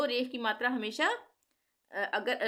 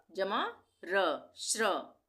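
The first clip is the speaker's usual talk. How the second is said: जमा र dragged out slowly; much slower than usual. Shown as a demonstration. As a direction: spell it out slowly. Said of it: "श्र कैसे बनता है?". (बहुत धीरे-धीरे समझाना)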